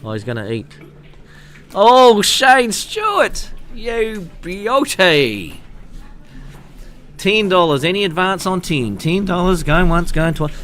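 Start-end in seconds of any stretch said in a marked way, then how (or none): 1.7-5.6 excited cheer. Real, not acted. Cheering a super chat donor. 7.2-10.5 fast auction patter, high and loud; auctioneer. Pretend. Auctioning the donation amount.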